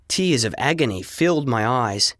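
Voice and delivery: plain reading voice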